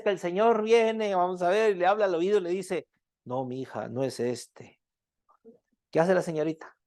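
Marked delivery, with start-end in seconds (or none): 3.2-4.8 God whispering. Acted. God speaks into her ear.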